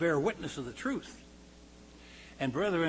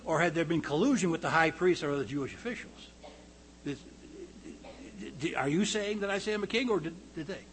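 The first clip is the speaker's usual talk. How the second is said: normal talking voice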